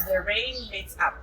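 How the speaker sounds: ordinary talk, nothing marked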